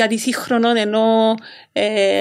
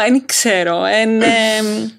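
ordinary talk, nothing marked

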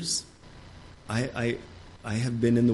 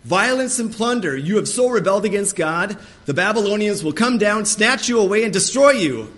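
higher, louder prophetic warning; urgent warning; 7 semitones higher, 6 dB louder than usual. Retold or imitated. Preacher voicing Jeremiah warning the people of Babylon, raised and urgent. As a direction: press the doom message home. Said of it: "Jeremiah is telling the true message".

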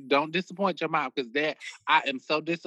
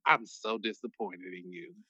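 quiet disappointment; 6 semitones lower, 9 dB quieter than usual. Retold or imitated. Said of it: "my mom was like".

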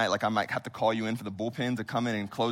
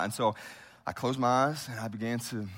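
ordinary delivery

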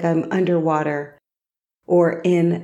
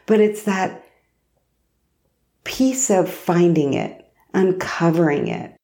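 ordinary talk, nothing marked

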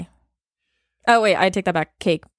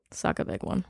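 whispered aside; 11 semitones lower, 11 dB quieter than usual. Real, not acted.

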